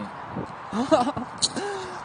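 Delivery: high laugh; amused laugh. Real, not acted. Streamer laughs at a comment on their accent, amused. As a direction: burst of high-pitched laughter.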